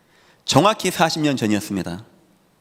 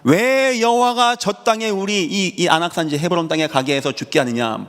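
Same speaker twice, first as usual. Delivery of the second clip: imitated complaint; 3 semitones higher than usual. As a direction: act out a crowd protesting bitterly to God. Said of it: "뭐라고 원망한지 아십니까".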